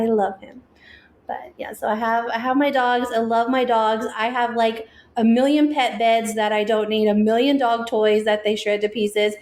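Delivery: ordinary talk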